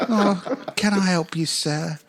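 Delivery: high, eager-to-serve orphan voice; little orphan. Acted. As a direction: offer help as a pitiful small urchin. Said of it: "this little one-legged orphan just limps up".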